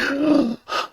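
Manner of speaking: high, shocked mock gasp; imitated gasp of outrage. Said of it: "you're like".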